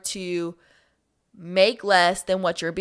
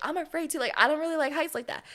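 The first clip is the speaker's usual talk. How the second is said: put-on nervous girl's voice; 7 semitones higher, 6 dB quieter, faster than usual. Voicing nervous girl at ride.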